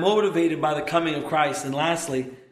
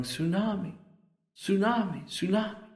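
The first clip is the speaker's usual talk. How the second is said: soft, higher-pitched echo of tearful cries; imitated weeping yell; 6 semitones higher, 4 dB quieter than usual. Preacher as the weeping man after the wave, weeping yell. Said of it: "He's weeping, yelling".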